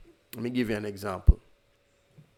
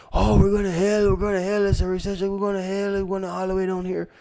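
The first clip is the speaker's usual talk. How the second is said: hammed-up panicky doom voice, much higher; mock panic; 10 semitones higher, 5 dB louder, faster than usual. Lecturer mocks panicking doomsayers, high hammed-up panic. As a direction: parody a scared investor crying doom, high and louder.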